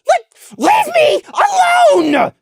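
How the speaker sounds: very high, loud, shrill cry; very high, furious shrieking. A narrator's reading. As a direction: force it through a clenched jaw. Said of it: "Prey shrilly managed".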